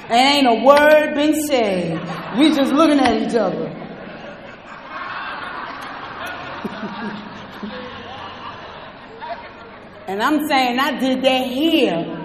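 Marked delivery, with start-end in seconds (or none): 3.7-10.0 long breathy unvoiced laugh; quiet rolling chuckle; airy laugh. Real, not acted. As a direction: let a soft wheezy laugh roll out. Laughing at the story.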